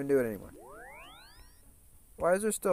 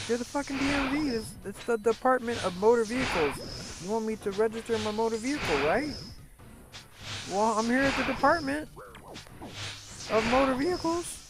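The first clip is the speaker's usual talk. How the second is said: exasperated disbelief; 12 semitones higher than usual. Retold or imitated. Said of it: "I'm like".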